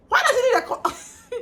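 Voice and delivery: high, loud shout of alarm; retold shout. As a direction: shriek the question in disbelief. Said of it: "I legit shouted".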